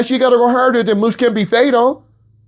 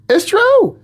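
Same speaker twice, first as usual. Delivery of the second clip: much higher insistent it's true; emphatic repeat; 14 semitones higher, 3 dB louder than usual. Real, not acted. Teacher insisting to students it's true, high emphatic. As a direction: insist on it, pitch leaping up. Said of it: "repeats for emphasis".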